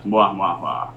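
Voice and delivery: half-hearted evil laugh. Retold or imitated. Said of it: "I'm supposed to do an evil laugh".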